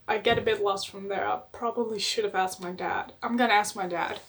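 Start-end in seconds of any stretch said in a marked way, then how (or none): none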